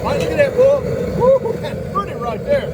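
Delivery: a high, delighted "Woo" over the crawfish pot; excited whoop. Real, not acted. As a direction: whoop with real joy at how it looks. Admiring the boiled crawfish.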